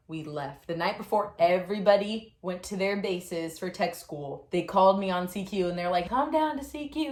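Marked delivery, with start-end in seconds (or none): 6.1-7.1 high-pitched mock summons; imitated call to report. Retold summons over intercom.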